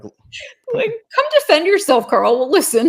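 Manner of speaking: high-pitched laughing challenge. Real, not acted. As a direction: call them out through real laughter. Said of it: "I'm laughing".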